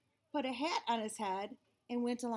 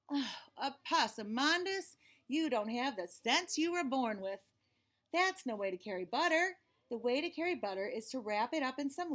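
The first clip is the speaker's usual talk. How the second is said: exasperated mama. Acted. Mama scolding Opossumandus for squashing the cake, exasperated. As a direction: sigh it out, then scold with patient exasperation.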